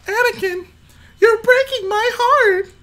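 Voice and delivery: very high, slow, heartbroken plea; a mock pleading falsetto; falsetto Padmé. Streamer hamming up Padmé's plea to Anakin, falsetto.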